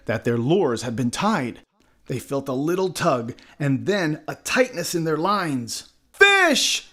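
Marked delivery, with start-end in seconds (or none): 6.1-6.9 high, loud shout; sudden high-pitched holler; excited exclamation. A narrator's reading. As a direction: cry out at a sudden bite. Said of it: "exclaimed the fishermen".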